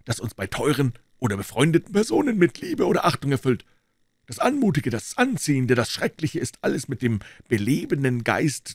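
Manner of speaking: a hint of a composed doctor; the doctor explaining why he came in, lightly voiced. A narrator's reading. Doctor speaking in the story.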